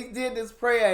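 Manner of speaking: ordinary talk, nothing marked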